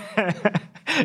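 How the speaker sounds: high burst of laughter. Real, not acted. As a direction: break up laughing at yourself. Laughs at his own pirate voice.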